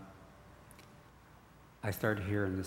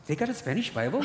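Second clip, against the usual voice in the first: put-on high, loud accented disbelief; astonished father; 10 semitones higher, 7 dB louder than usual. Father asking son if a Spanish Bible exists, astonished. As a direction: gasp it like an amazed old man.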